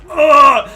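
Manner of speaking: loud pained grunt, short; a wounded man's grunt from the ground; dying grunt. Acted. Bleeding foe grunts up at the GM's players, loud grunt. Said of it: "he goes".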